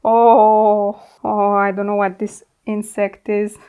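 ordinary talk

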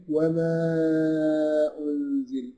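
وما with the long madd stretched slowly. Shown as a demonstration. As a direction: hold the vowel in وما long and slow. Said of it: "يجوز إن أنا أمده".

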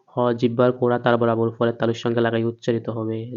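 usual speaking voice

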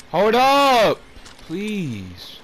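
high, loud shriek then plea; screaming at the game to wait; desperate yell. Real, not acted.

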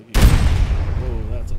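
startled whoa. Real, not acted. Surprised by grenade blast.

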